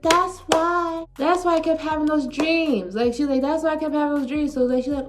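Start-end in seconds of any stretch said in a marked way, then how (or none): 0.0-3.0 high-pitched mock shock of realisation; imitating shocked aunt. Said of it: "she was shocked". 3.4-4.5 quick, high retold shock; imitating shocked aunt. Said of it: "she was shocked".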